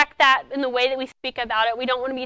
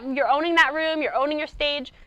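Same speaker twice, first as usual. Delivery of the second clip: usual speaking voice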